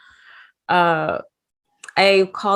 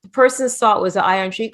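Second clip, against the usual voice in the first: normal talking voice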